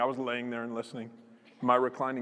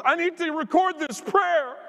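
high, loud imitation of an overcome woman; excited insistence; 13 semitones higher, 6 dB louder than usual. Preacher voices seatmate mid-prayer, excitedly insistent. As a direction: announce it in a pitched-up, urgent voice.

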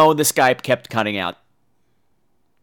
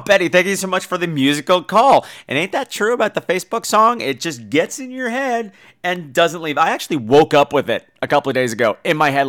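ordinary talk

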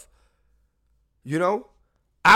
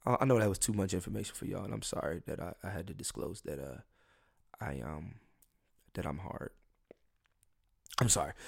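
much softer, sheepish mumbled apology; 8 semitones lower, 12 dB quieter, faster than usual. Real, not acted. Embarrassed comic apologizing to listeners, softly and sheepishly. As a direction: drop way down, embarrassed, owning the overshare.

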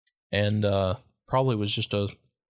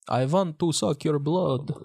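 slow, hammed-up Russian vampire; higher, drawn-out Dracula accent; 6 semitones higher, slower than usual. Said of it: "I was going into a Russian accent".

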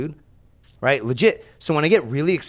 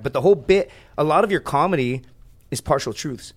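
ordinary delivery

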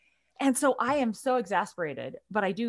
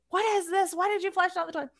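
scolding at a much higher pitch, half-yelled; retold screaming; 11 semitones higher, faster than usual. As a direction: imitate an exasperated parent calling through the house. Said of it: "I've been screaming this through the house".

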